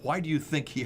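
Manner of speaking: usual speaking voice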